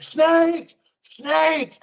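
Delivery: high, loud yell of snake; shouted warning. Retold or imitated. A camper shouting snake at the speaker, loud high yell. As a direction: call out the alarm, high and loud.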